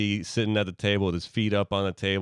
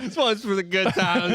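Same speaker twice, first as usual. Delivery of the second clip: higher toast; 10 semitones higher than usual. Shown as a demonstration. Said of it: "he says".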